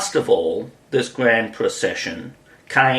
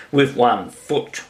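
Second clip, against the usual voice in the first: plain reading voice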